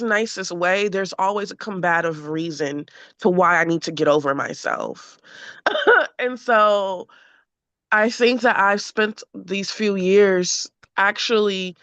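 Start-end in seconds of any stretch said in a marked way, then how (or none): 5.5-6.1 high laugh. Real, not acted. Laugh after venting frustration.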